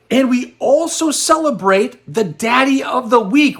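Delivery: big rising hype on Week; excited announcement. Real, not acted. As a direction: announce it with a showman's flourish. Announcing weekly top bidder.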